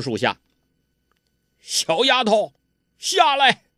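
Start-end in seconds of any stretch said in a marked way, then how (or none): 1.6-3.6 high, pushed-up villain voice barking an order; commanding villain. A narrator's reading. Ouyang Feng ordering Huang Rong down from the tree, high command.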